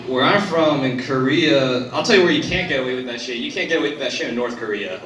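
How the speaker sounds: ordinary talk, nothing marked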